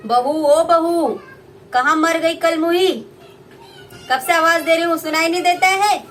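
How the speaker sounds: mother-in-law shouting at her daughter-in-law; shrill mother-in-law call. Acted. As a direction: yell across the house for the bride.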